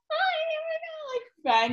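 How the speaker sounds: squealing very high hi; fangirling. Retold or imitated. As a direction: gush like a starstruck fan. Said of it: "fangirling like an idiot".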